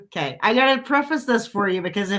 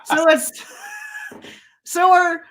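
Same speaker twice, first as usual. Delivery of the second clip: laughing; 11 semitones higher than usual. Real, not acted. Panicked laughing retelling.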